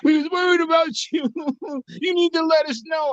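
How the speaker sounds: high mocking scold; mimicking worried friends. Mocking friends who fussed when he reappeared, high whiny. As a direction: whine it like fussing friends. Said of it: "and I popped out".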